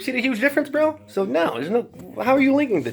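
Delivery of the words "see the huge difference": normal talking voice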